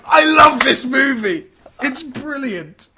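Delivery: high, drawn-out cry of delight; gleeful exclamation. Acted. Viewer thrilled by pensioner with an Uzi, gleeful shout.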